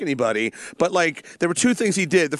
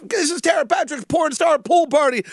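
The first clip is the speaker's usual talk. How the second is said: higher-pitched, drawn-out mock announcement; scripted cop line; 9 semitones higher than usual. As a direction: lightly play the cop surfacing to announce. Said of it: "come up out of the water, and go".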